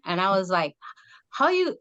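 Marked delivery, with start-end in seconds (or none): 1.3-1.8 high-pitched disbelieving start; incredulous. Retold or imitated. Talking about aunts losing their accent, incredulous. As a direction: recall being baffled by relatives. Aside quoting her surprised reaction.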